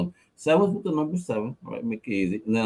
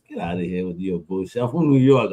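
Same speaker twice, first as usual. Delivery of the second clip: dismissive; 6 semitones lower than usual. Retold or imitated. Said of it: "I'm like".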